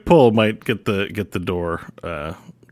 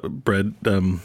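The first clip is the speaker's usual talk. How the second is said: ordinary talk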